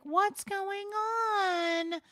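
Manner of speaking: high, very slow fake-crying plea; hammed-up sobbing neediness; mock whiny begging. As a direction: whimper it out like a clingy friend. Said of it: "chase you and be like".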